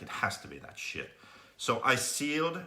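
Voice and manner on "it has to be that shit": muttered realization. Retold or imitated. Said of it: "I'm like".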